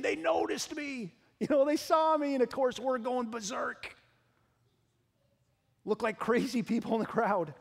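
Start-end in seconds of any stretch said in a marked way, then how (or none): 0.0-2.3 daughter's inner voice. Retold or imitated. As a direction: voice a thrilled little girl, higher. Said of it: "inside she's saying".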